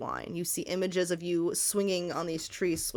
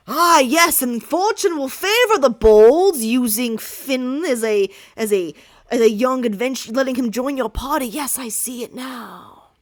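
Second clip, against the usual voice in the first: fortune teller voice; 4 semitones higher, 10 dB louder than usual. Acted. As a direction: boom the prophecy to the party. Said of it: "fortune teller persona".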